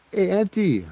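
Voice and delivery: high, polite call. Acted. Driver calling to old lady from truck window, high and polite.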